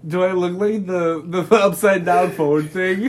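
ordinary talk, nothing marked

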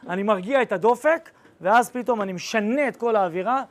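ordinary talk, nothing marked

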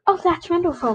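a delighted exclamation; cried out. A narrator's reading. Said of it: "cried Dawn".

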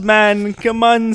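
put-on loud, high Jamaican accent. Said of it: "one of my favorite accents".

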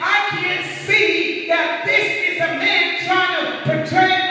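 loud, high, driving sermon voice; full-force preaching. Real, not acted. Preacher urging the church to seek discernment, full-force preaching. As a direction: build insistently, hammering each point at the congregation.

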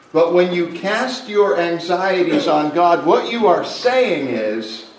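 loud, driving pulpit delivery; preaching at full force. Acted. Pastor pressing the church on pride, loud preaching. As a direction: press the point home to the congregation.

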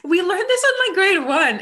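high, loud outburst of disbelief; incredulous squeal about easy homework; indignant. Real, not acted. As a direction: pitch it up high and loud in outrage. Complaining friends' chat.

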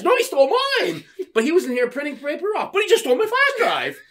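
put-on very high, squeaky indignant old lady; mock old lady insisting. Old lady insisting to the staff he took it, squeaky high. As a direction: push it up high like a wronged granny. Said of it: "And they're all like".

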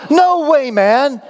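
high-pitched, gullible American amazement; bad American accent. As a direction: exclaim it like a hammed-up awestruck American. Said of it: "This is a terrible accent".

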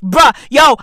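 ordinary talk, nothing marked

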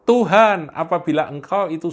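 high, loud cry of Tuhan. Acted. Peter calls out to Jesus on the water, loud cry. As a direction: call out to the Lord across the water. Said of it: "Petrus berseru".

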